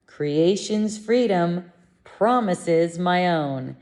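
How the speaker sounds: slow, deliberate affirmation; measured lesson line, drawn out; slow recitation. Real, not acted. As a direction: recite it slowly, phrase by phrase. Repeats the lesson affirmation for practice.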